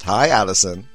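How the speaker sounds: ordinary talk, nothing marked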